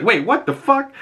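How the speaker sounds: high startled outburst; shocked Harcourt. Retold or imitated. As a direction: jolt up in disbelief.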